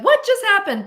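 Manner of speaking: high, loud burst of bafflement; mock disbelief. Viewer reacting to an unrealistic drama twist, mock disbelief. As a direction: exclaim it way up high, incredulous.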